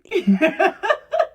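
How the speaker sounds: a bright burst of self-mocking laughter; high laugh. Real, not acted. Mother laughing at her own temper, high laugh. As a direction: laugh at yourself, rising high.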